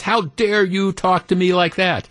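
high-pitched mock indignation; retold outrage. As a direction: imitate an outraged yell without the volume. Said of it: "she's yelling and cursing at him".

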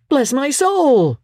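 startled by the sudden visitor; surprised cry. Acted. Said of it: "cried Fred".

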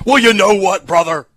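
loud, high pro wrestler yell; wrestling promo shout. Acted. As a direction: heroic rallying shout like a wrestler. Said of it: "That actually sounded like it".

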